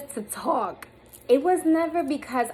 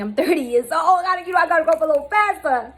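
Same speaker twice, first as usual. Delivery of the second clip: laughing; 8 semitones higher, 7 dB louder, faster than usual. Real, not acted. Self-mocking laugh about her age.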